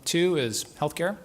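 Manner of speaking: normal talking voice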